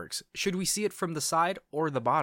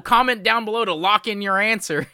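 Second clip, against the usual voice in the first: loud, high clickbait host voice; mock a viral video host; viral video guy; 7 semitones higher, 10 dB louder than usual. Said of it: "viral video guys who are like".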